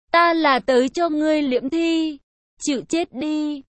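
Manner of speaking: plain reading voice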